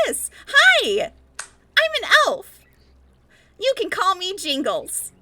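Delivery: high, loud chirpy Christmas elf. Shown as a demonstration. Host previewing an elf voice for the stream, squeaky and high. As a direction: squeal it out as an over-eager little elf. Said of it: "give us a preview of your elf voice".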